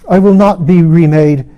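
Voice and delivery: high, loud, defiant anguish; tormented proud queen. Acted. Lilith defies her maker before Mara, loud and anguished. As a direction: hurl every word at heaven, raging and desperate.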